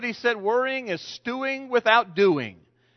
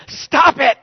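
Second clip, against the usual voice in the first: loud, higher comic yell; mock yell; 6 semitones higher, 10 dB louder than usual. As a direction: snap it at her loudly. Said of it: "And he keeps saying".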